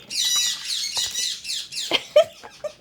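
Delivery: squeaky high-pitched laugh; very high giggle. Real, not acted. Owner giggling at her bird, very high laugh. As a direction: let out a tiny shrill giggle.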